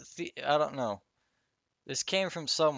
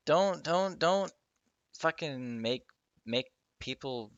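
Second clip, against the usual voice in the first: ranting; 4 semitones higher than usual. Real, not acted. Podcaster venting to listeners, ranting rising don'ts. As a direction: vent it, pleading and fed up. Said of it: "I'm ranting".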